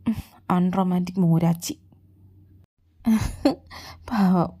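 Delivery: usual speaking voice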